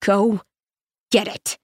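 pained words forced through teeth; through clenched teeth. Acted. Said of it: "forces her words through clenched teeth".

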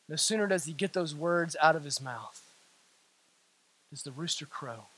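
low, soft, grave storytelling with long pauses; hushed preaching. Real, not acted. Preacher tells of Peter and the rooster, hushed preaching. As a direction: sink right down after the outburst.

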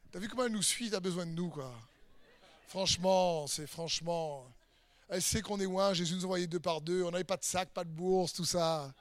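low, quiet smug chat among mates; self-important. Acted. Disciples boasting among themselves of being followed, self-important. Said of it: "Ils s'y croient".

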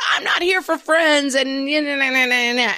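high, whiny mock rant; hammed-up reality-show fury dissolving into nah-nah babble; super angry girl. Reality show contestant refusing friendship, mock angry whine. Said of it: "the super angry girl is like".

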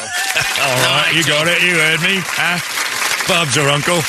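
put-on cheeky British accent. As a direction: play a winking British lad. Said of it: "Oh, Nigel".

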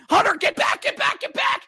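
high, screaming plea to the goalie; desperate shout. Real, not acted. Announcer pleading with goalie Hunter, screaming. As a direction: scream at him to get back. Begging goalie to get back.

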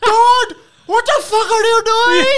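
very high, loud, stretched-out outrage; hammed-up desperate screaming; horrified scammer shriek. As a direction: imitate total panic at full volume. Acting out scammer panicking at redemption.

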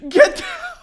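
high, loud yell breaking into laughter; mock screaming. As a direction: kids screaming across a lunchroom, cracking up. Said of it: "just start screaming".

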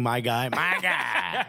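put-on slow, lazy Southern accent drawl. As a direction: stretch it out like a Southern good ol' boy. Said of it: "in a Southern accent saying".

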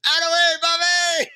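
high, loud comic yell; mock shout. Cohost yelling at Bobby to move, comic shout. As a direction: holler it at the departing truck. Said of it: "And I said".

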